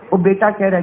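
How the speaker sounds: ordinary talk, nothing marked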